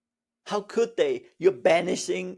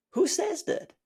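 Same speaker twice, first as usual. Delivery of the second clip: much higher, rising disbelief; incredulous; 12 semitones higher than usual. Real, not acted. Teacher mocking the rule, high rising disbelief. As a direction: ask it as if it's absurd.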